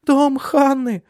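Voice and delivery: put-on very high, loud child wail; sobbing child. A narrator's reading. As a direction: cry out in a little girl's distress. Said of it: "всхлипнула Суль". (детский вопль со всхлипом)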